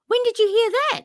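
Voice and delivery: high-pitched, incredulous when did you hear that; surprised question. Retold or imitated. Husband doubting his wife's radio story, incredulous and high.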